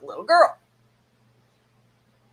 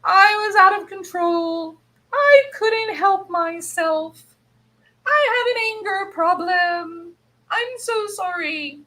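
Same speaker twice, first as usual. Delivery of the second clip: mock contrite; 12 semitones higher, 4 dB louder than usual. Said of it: "Then she says".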